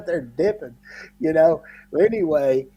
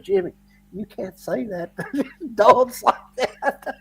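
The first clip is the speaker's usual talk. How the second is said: scolding through laughter; 7 semitones higher than usual. Retold or imitated. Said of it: "he goes".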